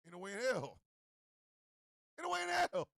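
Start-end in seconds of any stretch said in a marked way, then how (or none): 2.2-2.9 high-pitched comic refusal; emphatic disbelief. Acted. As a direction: push the refusal up high, scandalized. Repeats refusal, raised.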